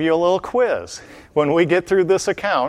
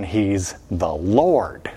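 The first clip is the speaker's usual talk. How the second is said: slow emphasis; 6 semitones lower, much slower than usual. Real, not acted. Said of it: "emphatic sermon point".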